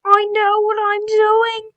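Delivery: high, loud and slow, full of swagger; James impression. The cocky engine James brushing off his driver in a high, loud, slow voice. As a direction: puff up like a cocky show-off engine. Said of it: "let me do my James impression".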